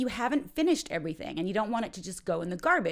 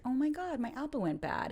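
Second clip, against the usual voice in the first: higher-pitched mild dismay; 6 semitones higher, 3 dB quieter than usual. Acted. Speaker finds an apple gone bad in their bag, mildly dismayed. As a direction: react with a touch of alarm.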